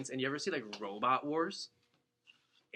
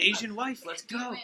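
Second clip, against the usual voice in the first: higher, louder burst of excitement; hyped-up cheer; 10 semitones higher, 6 dB louder than usual. Retold or imitated. Hyped about the rumoured Cutco chopsticks, excited cheer. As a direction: cheer it like the best news ever. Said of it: "I was hyped".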